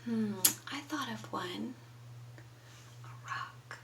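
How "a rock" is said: soft, hushed whisper. Shown as a demonstration. Teacher telling Elephant the thing she chose, whispering. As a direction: whisper the answer confidentially. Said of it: "A rock is what I told Elephant".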